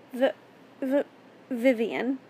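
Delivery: frightened stutter on the first sound; stammering. A narrator's reading. Captive stammers her name to her captor, faltering. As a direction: trip over the name twice before it comes out. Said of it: "I stammered".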